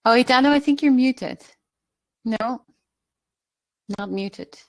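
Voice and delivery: usual speaking voice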